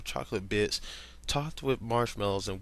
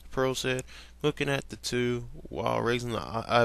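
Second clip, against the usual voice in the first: plain reading voice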